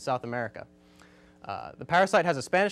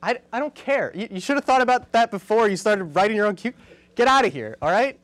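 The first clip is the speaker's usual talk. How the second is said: higher, louder staged telling-off; mock scolding; 5 semitones higher, 4 dB louder than usual. Host fires the cue card guy in a bit, mock outrage. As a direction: act fed up and order him out.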